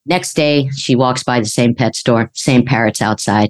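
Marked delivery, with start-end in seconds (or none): none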